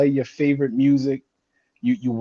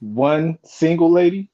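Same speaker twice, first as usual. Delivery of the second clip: slow, higher "One single lady?" as a question; questioning echo; 4 semitones higher than usual. Real, not acted. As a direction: draw out "single lady", querying what was heard. Misheard sign-off, questioning repeat.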